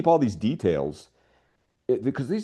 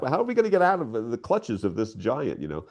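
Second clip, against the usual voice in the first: ordinary talk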